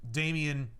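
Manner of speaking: ordinary talk, nothing marked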